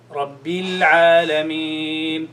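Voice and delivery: Rabbil Alameen very slow with a clear i on bil; clear kasra. Shown as a demonstration. Teacher corrects the student's Rabbil with a slow model. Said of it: "It's not Rabbil".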